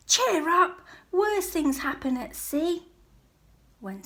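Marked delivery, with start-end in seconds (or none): 0.1-2.9 Soggy's voice. A narrator's reading. Soggy about to cheer duck.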